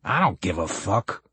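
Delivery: gruff voice with a light southern twang. A narrator's reading. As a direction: growl it like a callous Southerner. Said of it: "said a gruff voice with a southern twang".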